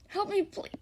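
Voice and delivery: high-pitched plea, no real scream; understated narrated cry for help. A narrator's reading. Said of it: "He finds you screaming".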